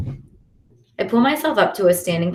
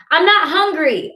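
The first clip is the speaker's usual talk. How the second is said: higher, slow sulky yell; yelling back; 10 semitones higher, 4 dB louder than usual. A narrator's reading. Sulking boy yells back at his sister, raised. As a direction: yell it back through the door. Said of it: "I yell back".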